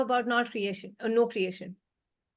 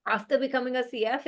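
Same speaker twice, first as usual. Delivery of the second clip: ordinary delivery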